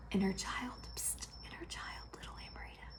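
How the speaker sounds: faint breathy whisper calling a name; whispering inner voice. Retold or imitated. Inner voice calling to her younger self, whispered. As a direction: hush it like a voice from far inside.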